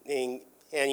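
ordinary delivery, nothing marked

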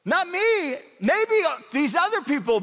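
high-pitched, acted disbelief; flabbergasted Peter. Disciple denying he would betray Jesus, flabbergasted. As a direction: protest in a hammed-up, high, astonished voice. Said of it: "Peter is just flabbergasted".

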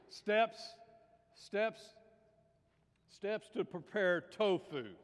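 big, slow joke setup; loud announcing. Acted. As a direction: announce the title slowly and loudly. Loudly announces joke title.

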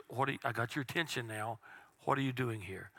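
hushed and gentle, barely above a breath; soft whisper of God. Acted. Preacher voicing God gently calling to Elijah, hushed. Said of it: "It wasn't loud".

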